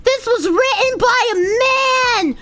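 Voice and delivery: high whiny outraged commenter voice; shrill mock outrage, rising to a yell; mock angry commenter. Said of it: "People being like".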